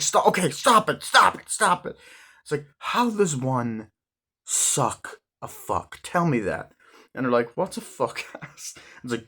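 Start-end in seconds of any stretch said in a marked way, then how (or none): 0.0-2.0 high, put-on "stop it" pleading; mock pleading. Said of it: "she's like". 2.8-6.7 slow mock question. Sister mock-questions the insult. 7.6-8.7 higher, whispered, breaking into a laugh; whispered laughing question. Retold or imitated. Parents puzzled, laughing.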